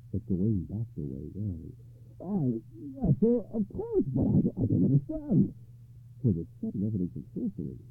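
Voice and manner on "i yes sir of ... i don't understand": high, faltering bewilderment; flustered, uneasy character voice; confused and uneasy. A narrator's reading. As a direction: stammer it out, pitched well up. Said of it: "made him confused and uneasy".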